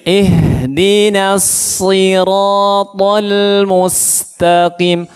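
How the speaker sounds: slow, sustained melodic recitation; Quran recitation. Shown as a demonstration. Teacher chants a verse for pupils to repeat, slow recitation. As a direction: draw out each long vowel evenly, chanting slowly.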